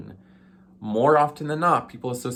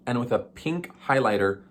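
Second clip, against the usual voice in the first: ordinary delivery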